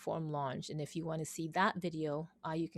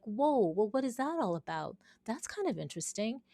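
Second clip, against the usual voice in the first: higher-pitched retold "whoa"; lightly played surprise, lifted in pitch; intrigued surprise; 5 semitones higher than usual. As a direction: act out a curious first reaction. Retelling her intrigued reaction.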